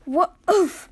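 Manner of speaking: loud, short startled oof; high, abrupt cry on landing; impact grunt. Acted. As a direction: get the wind knocked out. Lands hard on the racetrack.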